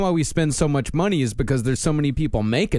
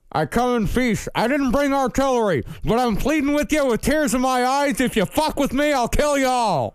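General Mattis; 10 semitones higher than usual. Retold or imitated. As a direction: growl it like a tough general. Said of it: "He said".

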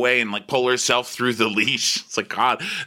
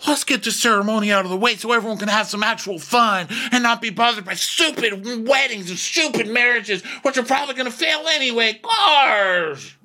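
high, whiny mock rant that speeds up; mock Shep ranting about weddings in a high whine; 13 semitones higher than usual. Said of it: "And Shep's like".

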